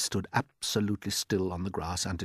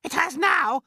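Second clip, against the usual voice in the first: a far higher, louder aunt's shout; Aunt Spiker shouting; 7 dB louder than usual. Acted. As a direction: screech it out as the nasty aunt.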